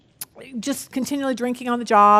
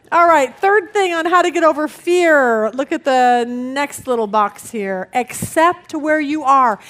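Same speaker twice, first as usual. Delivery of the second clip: usual speaking voice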